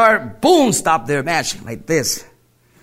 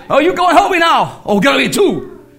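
demanding; 10 semitones higher, 4 dB louder than usual. Retold or imitated. Killer orders him to help.

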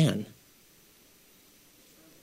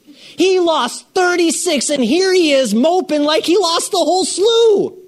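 incredulous; 11 semitones higher, 4 dB louder than usual. Real, not acted. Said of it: "preacher's incredulous emphasis".

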